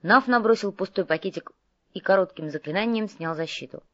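usual speaking voice

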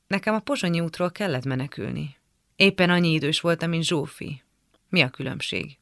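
ordinary delivery

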